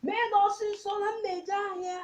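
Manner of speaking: put-on high-pitched mother calling her child. Mother calling her daughter to bring the siblings, high calling voice. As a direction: call out high to your child. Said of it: "is the voice of my mother".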